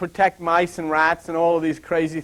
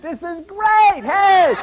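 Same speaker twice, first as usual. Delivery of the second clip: much higher, slow then a loud "hey!"; happy dog voice; 12 semitones higher, 5 dB louder, much slower than usual. Pretend. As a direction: voice the delighted dieting dogs. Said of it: "The dogs who are on a diet think".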